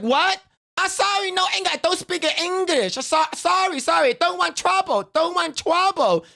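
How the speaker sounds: high-pitched broken English pleading; fake broken English. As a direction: act the helpless foreigner, high and apologetic. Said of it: "She's like, she's like".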